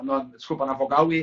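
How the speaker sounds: usual speaking voice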